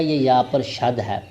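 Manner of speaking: usual speaking voice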